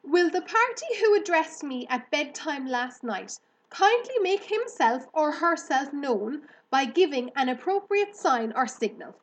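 put-on high, loud young pig calling out to the barn; Wilbur calling out. A narrator's reading. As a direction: call out to the unknown friend, high and eager. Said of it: "Attention, please," he said. "I will repeat the message".

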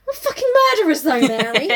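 high, loud hammy declaration; over-the-top gangster brag; mock villain boast. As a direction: belt it out like a proud outlaw.

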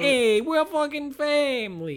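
high, slow mock mobster; lightly hammed Italian mob guy. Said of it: "my Italian guy bit".

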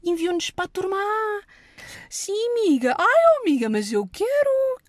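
high, loud gushing gossip voice; squealing "ai amiga" chatter; bubbly sorority girl. Retold or imitated. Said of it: "com estes estudantes de Direito quando estão numa mesa". (voz de patricinha tagarela e aguda)

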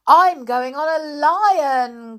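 put-on high, loud, slow child voice; excited child. A narrator's reading. Third child choosing a ride, high and proud. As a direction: call it out proudly. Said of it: "cried a third one".

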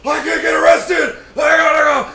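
high loud panicked yell; panicked shout. Retold or imitated. Friend Moose bolting from the cops, high panicked yell. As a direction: flee in a hammed-up panic. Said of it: "And then Moose is like".

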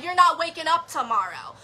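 usual speaking voice

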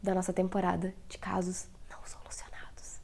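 sussurro sem voz; sussurrando. Real, not acted. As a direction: sussurre o encerramento. Encerramento sussurrado do podcast. (sussurrando o fim do episódio)

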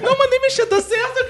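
high laughing repeat of the quote. Retold or imitated. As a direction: laugh through the words.

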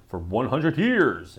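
loud mock-epic declamation; Morpheus proclamation. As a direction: boom it out like a rallying speech. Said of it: "and I did that".

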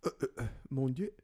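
fast mock French flustered; hint of a French accent; french chef. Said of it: "He's like".

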